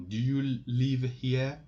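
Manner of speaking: "Do you live here?" lifting on HERE; rising question intonation. Shown as a demonstration. French teacher gives learners an English example, rising question intonation. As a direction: let the pitch climb toward "here?". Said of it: "you will make your voice up".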